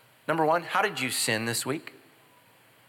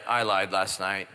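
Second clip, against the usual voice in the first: slow sheepish confession; 7 semitones lower, slower than usual. Retold or imitated. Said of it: "I was like".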